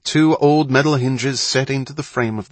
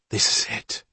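hushed. Acted. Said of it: "he said".

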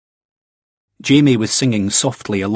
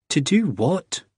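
suspicious; 7 semitones higher, slower than usual. Acted. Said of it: "Ian asked suspiciously".

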